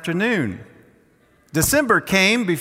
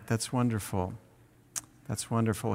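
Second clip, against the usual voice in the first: hushed awe; 5 semitones lower, 10 dB quieter than usual. Real, not acted. Preacher savours the translation.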